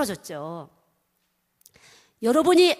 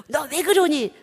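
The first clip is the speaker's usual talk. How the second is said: loud, higher-pitched mock telling-off; scolding parent; 5 semitones higher, 6 dB louder than usual. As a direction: scold a child in mock exasperation. Voicing a parent scolding children.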